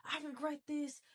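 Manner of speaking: lightly hammed-up victim voice; mock regretful whine. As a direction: mimic a self-pitying sigh of regret. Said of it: "It's like".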